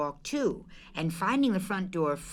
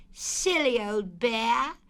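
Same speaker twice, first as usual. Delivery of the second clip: slow, loving pet name; tender, fond and slow; 7 semitones higher, slower than usual. Acted. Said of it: "in such a loving voice".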